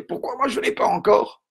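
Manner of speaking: high, loud whiny yell of an impatient student; mock student yell. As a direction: shout it like a kid who feels left out. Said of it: "qui doivent hurler".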